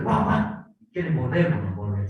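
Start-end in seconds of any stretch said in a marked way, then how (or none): 0.0-0.7 mock dog bark. Preacher imitates a barking dog.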